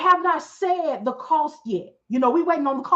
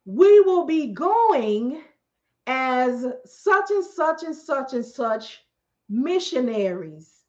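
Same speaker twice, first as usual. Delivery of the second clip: slow reveal; 2 semitones lower, much slower than usual. Retold or imitated. Recruiter reveal, dramatic slowdown.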